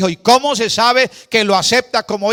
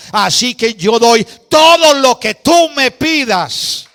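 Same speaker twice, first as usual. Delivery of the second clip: louder and higher, proclaiming with full force; fervent preaching; 5 semitones higher, 6 dB louder than usual. Acted. Pastor to his congregation, fervent full-force preaching. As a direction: preach it out to the whole church. Redeemer's generosity proclaimed loudly.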